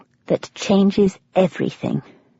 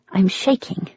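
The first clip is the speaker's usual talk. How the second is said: ordinary delivery, nothing marked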